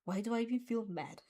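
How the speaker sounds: plain reading voice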